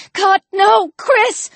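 high, alarmed exclamation; horrified. A narrator's reading. Alex fears Chris was shot, horrified. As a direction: cry out in dread for someone. Alex's horrified thought at the gunshot.

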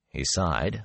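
ordinary delivery

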